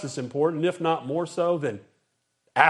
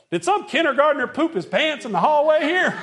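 higher, laughing mock disgust; mock outraged colleague; 8 semitones higher than usual. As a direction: ham up a teacher sniffing the air. Colleague smelling breath down hall.

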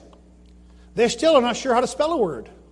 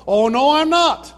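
high, loud, drawn-out denial; indignant protest; 6 semitones higher, 6 dB louder, slower than usual. Retold or imitated. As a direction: imitate an emphatic, slow protest. Retold translator protests being told.

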